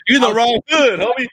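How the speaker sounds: real amused ribbing; excited teasing. Real, not acted. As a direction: throw the joke out loud and high. Teasing punchline amid laughter.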